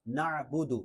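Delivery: "نعبد" with a distinct throaty ain; clear ain. Shown as a demonstration. Teacher models for learners, "نعبد" with clear ain. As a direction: make the ain in "نعبد" audible. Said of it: "عین ہے تو اس کو واضح کرنا ہے".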